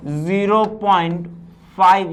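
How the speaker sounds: Zero point spoken slowly, word by word; slow dictation. Shown as a demonstration. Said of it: "कह दिया गया". (धीरे-धीरे बोलकर लिखवाना)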